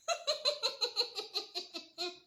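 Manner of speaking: long, high villain cackle; machiavellian laugh. Shown as a demonstration. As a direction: cackle like a mustache twirling villain. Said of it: "je fais très bien le rire machiavélique". (rire machiavélique de méchant)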